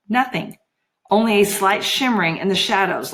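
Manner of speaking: usual speaking voice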